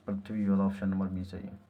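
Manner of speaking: ordinary talk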